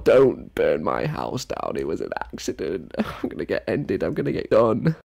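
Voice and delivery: hammed-up panicked whimper; mock pleading. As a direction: beg in fake terror, voice wavering. Mock begging after misgendering her.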